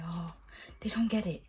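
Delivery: ordinary talk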